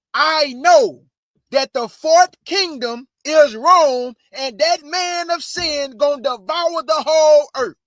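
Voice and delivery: high, loud, slow preaching climax; fiery preaching. Acted. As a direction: preach it out to the congregation, slowly. Preaching at full force.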